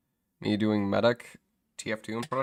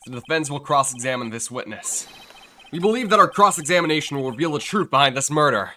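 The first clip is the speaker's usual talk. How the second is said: declamatory courtroom announcement, building louder; determined defense attorney; 3 semitones higher, 6 dB louder than usual. Acted. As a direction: proclaim the challenge to the court. Voices defense attorney in game.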